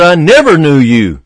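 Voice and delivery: emphatic "never", pitched above the other words; stress on never. Retold or imitated. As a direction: hit the word "never" firmly. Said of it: "I emphasize that word never".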